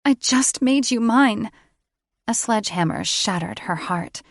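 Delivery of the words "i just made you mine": despairing. A narrator's reading. Heroine despairs.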